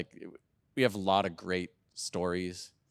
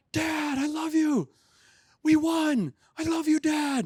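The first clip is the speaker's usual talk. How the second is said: much higher, louder, elated mock teen shout; joyful shouting son; 6 dB louder, slower than usual. Son calling dad after the win, elated shout. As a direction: play the son yelling with victory joy. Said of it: "he's like".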